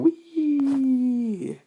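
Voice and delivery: a joyful shriek while flying off; a tiny voice squealing in delight; gleeful wee. Retold or imitated. Ghost kicked away like a ball.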